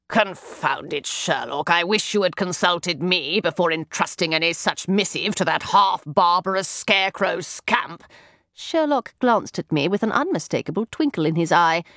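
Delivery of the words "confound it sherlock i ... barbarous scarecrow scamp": a lightly voiced exasperated older brother; Mycroft, irritated. A narrator's reading. Mycroft scolding Sherlock about their sister, mildly irritated.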